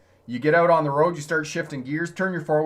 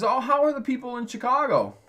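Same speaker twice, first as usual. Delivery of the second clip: lightly voiced curious New Yorker, pitched up high; New Yorker asking; 11 semitones higher than usual. New Yorker asking the Chicago guy about locals, mock-curious, higher. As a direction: ask it with wide-eyed interest, higher. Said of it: "he says".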